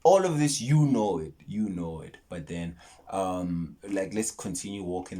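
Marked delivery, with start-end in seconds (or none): 0.6-1.3 punchy and louder, stress on you; emphatic. Real, not acted. To viewers, punching the catchphrase hard. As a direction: hit "you know it" firmly. Repeating the sign-off phrase.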